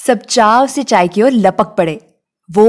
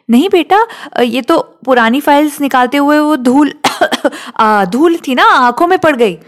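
flustered father; 5 semitones higher than usual. Acted. Father hides tears, flustered excuse.

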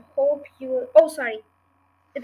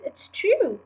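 cried; 7 semitones higher, 4 dB louder than usual. A narrator's reading. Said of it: "cried the small foxes".

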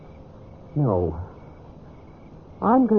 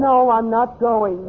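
slow, high, loud refusal; defiant refusal; 11 semitones higher, 5 dB louder, slower than usual. Acted. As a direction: dig in your heels, loud and slow. Refusing to leave the cabin.

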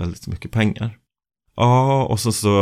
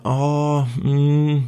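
coaxing; 7 semitones higher, slower than usual. Retold or imitated. Sweet-talking the saleswoman.

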